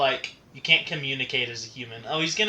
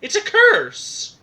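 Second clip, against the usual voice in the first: dramatic villain; 8 dB louder, slower than usual. Pretend. Dramatic genie curse reveal.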